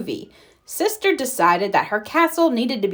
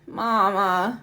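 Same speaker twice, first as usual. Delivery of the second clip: low croaky voice. A narrator's reading. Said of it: "in a low, croaky sort of voice".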